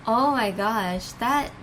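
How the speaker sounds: normal talking voice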